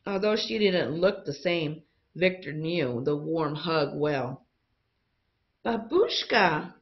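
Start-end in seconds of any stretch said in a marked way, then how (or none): 5.6-6.7 higher, slow drawn-out call of recognition; joyful cry. A narrator's reading. Boy recognizing his grandmother, joyful cry. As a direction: call her name with relief. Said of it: "Victor cried".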